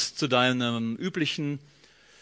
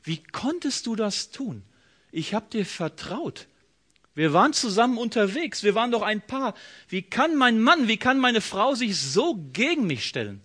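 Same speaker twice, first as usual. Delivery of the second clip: betrayed partner pleading, pitched up; hurt reproach; 6 semitones higher than usual. Acted. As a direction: plead in higher, wounded disbelief. Acted reproach of a betrayed spouse.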